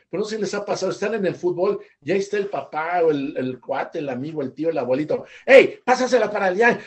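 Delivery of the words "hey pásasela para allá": loud fan yelling a pass at the screen. Retold or imitated. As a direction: shout at the TV like an angry football fan. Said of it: "y le gritan a la tele". (gritándole a la tele como aficionado)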